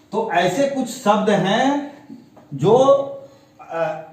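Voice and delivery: ordinary talk, nothing marked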